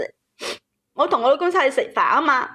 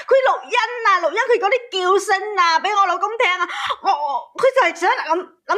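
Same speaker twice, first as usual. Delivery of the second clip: outraged; 7 semitones higher than usual. Real, not acted. Caller outraged retelling rival's recording.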